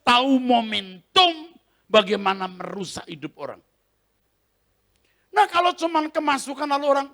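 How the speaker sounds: high, loud fervent preaching. Acted. Preacher warning the congregation, full force. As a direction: drive the warning home from the pulpit.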